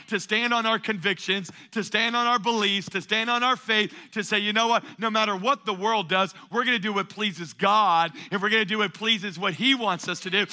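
rally the church, building to a peak; preaching. Acted. Preaching climax, rising intensity.

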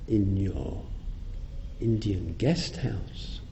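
ordinary talk

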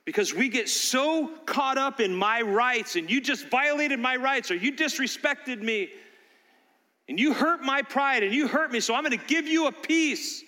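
forceful preaching. Acted. Pastor urging the congregation, forceful preaching. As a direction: drive the lesson home.

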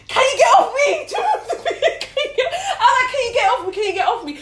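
high, loud shout mixed with laughter. Retold or imitated. Chased down the stairs, shouting at him laughing.

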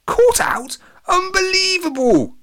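very high, loud, outraged disbelief; indignant cry. A narrator's reading. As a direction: shriek it in disbelief. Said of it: "cried Latham".

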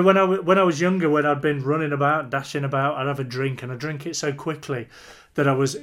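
ordinary talk

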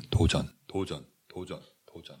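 fading echo, repeated and trailing off; echo effect. Pretend. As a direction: voice echoing away into the distance. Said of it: "메아리 효과".

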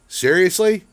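high, loud disbelief; incredulous retort; exasperated. Retold or imitated. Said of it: "And I'm like".

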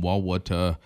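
"wawata" for water, split into extra syllables; drawled Southern "wawata"; multi-syllable water. Retold or imitated. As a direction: say "wawata" as wah-wuh-tuh. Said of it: "turning water into multiple syllables".

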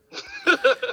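high-pitched burst of laughter; loud, high laugh; real laugh, pitched well up. Real, not acted. Laughs after his own remark.

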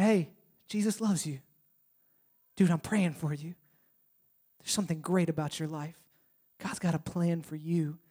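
soft, tender reassurance; gentle. Retold or imitated. Friend reassuring someone of God's love, softly. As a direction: speak quietly like a caring friend. Gentle words of the good example.

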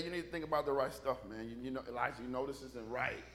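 low, quiet reasoning; hushed, confiding appeal; servant gently advising. Acted. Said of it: "His servant wanna say".

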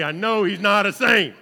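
firm declaration with saint stressed and falling; emphatic. Real, not acted. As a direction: proclaim it to the room. Repeats the line as a statement.

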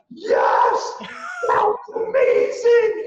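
high, loud, slow cheer of triumph; triumphant shout. Retold or imitated. Bragging to friends about the Starbucks pitch, triumphant shout. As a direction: celebrate like you just won. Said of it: "I'm like".

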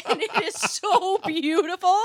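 giggling delight; laughing. Real, not acted. Laughing after the impression.

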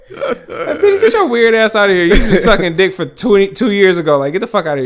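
high, loud, laughing yell at the ex; furious outburst. Retold or imitated. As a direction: tell her off loudly, half laughing. Mocking outburst at ex.

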